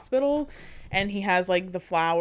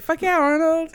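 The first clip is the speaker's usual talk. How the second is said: loud, high cheer; excited cheer; 9 semitones higher, 6 dB louder than usual. Acted. Cheering Arnold's line.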